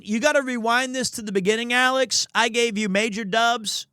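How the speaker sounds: usual speaking voice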